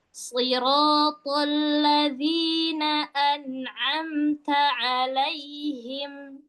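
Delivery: slow, sustained tartil; drawn-out model recitation; Quranic recitation. Shown as a demonstration. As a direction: chant slowly and let the ending fade. Teacher demonstrates slow recitation.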